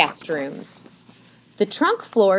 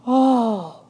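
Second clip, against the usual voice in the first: awed, breathy "oh", pitched up; breathed; 6 semitones higher than usual. A narrator's reading. Mona sees the view, breathing her awe. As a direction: breathe it out in wonder. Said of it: "breathed Mona".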